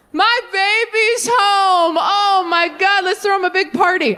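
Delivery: high, drawn-out gushing delight; overjoyed welcome. Acted. Dad welcoming the prodigal son home, overjoyed and high. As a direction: cry out joyfully, stretching the words. Father joyfully greets returning son, acted.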